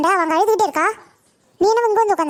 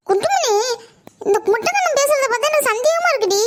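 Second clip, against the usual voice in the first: a much higher, laughing mother's voice; suspicious mother; 6 semitones higher than usual. Acted. Mother confiding her suspicion to her friend, high with a laugh. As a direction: play the doubtful mother, half laughing.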